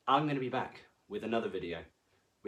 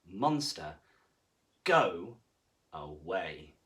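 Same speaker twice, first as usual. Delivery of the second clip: stern sign; 7 semitones lower, slower than usual. Acted. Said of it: "a sign that said".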